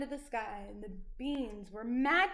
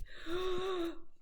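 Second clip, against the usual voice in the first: sharp gasp; 6 semitones higher than usual. Acted. Room begins to shake.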